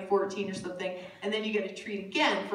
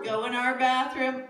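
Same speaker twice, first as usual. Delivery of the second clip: ordinary talk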